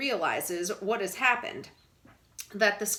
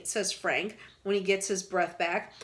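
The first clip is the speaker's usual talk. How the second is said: plain reading voice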